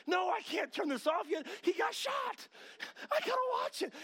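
high, loud, worked-up shout; over-the-top desperate pleading; frantic protest. Acted. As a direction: yell like a hooked viewer who can't switch off. Acts out binge watcher refusing to stop.

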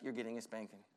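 low, quiet dad's threat; a hint of a stern father warning. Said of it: "He's like".

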